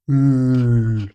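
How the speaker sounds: long, loud drawn-out hum of L; voiced L sound. Shown as a demonstration. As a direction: hold the L as a sustained voiced sound. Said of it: "sounds like".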